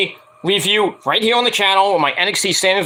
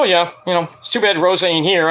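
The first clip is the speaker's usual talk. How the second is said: ordinary talk, nothing marked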